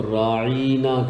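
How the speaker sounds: راعینا with the middle "ee" stretched long; long-held "ee" inside راعینا; lengthened vowel. Shown as a demonstration. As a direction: draw out "raa-EEE-naa" in راعینا. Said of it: "تھوڑا سا اور لمبا کر کے".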